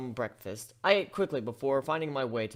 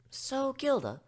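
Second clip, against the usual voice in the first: higher, gentle questioning pony voice; curious pony; 11 semitones higher than usual. Acted. Pony asking Gilda why she came, gentle and curious. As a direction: ask her kindly.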